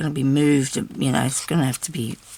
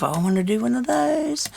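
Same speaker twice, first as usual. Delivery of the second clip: eager inner voice; 7 semitones higher than usual. Retold or imitated. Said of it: "thought to myself".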